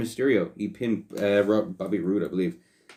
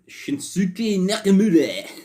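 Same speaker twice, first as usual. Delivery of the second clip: drawn-out, high, slow mock Japanese pronunciation of "Shinsuke Nakamura"; "Shinsuke Nakamura" stretched out slowly in a higher, hammed-up Japanese accent; 11 semitones higher, 5 dB louder, slower than usual. Host reads a wrestler's name slowly in a mock Japanese accent.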